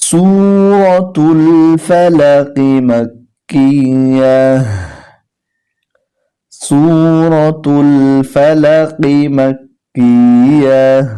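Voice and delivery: slow, drawn-out chanted recitation; measured tajweed chant; Quran recitation. Shown as a demonstration. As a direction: intone each word long and melodic.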